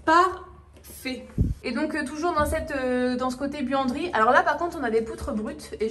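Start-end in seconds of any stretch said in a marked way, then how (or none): 0.0-1.3 very slow, louder, syllable by syllable; emphatique, syllabes détachées. Real, not acted. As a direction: spell out the verdict in big separate beats. Verdict emphatique sur le placard. (en détachant chaque syllabe avec emphase)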